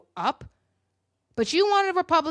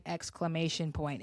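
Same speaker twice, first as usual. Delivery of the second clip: exclamation point, said low and much softer; dropped to a mutter; 6 semitones lower, 9 dB quieter than usual. Shown as a demonstration. Reading the tweet to viewers, soft low aside. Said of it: "why am I saying exclamation point".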